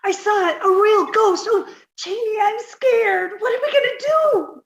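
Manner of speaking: high, pleading and frightened; scared voice on the edge of tears; terrified. Acted. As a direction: beg Jane in panic. Irma frightened by the ghost.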